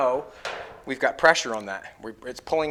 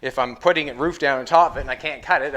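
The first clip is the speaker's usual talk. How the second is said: ordinary talk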